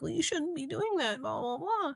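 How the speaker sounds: high, soft, sing-song judging voice; imitated tut-tutting in a small high voice; mock scolding. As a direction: mimic a prissy telling-off.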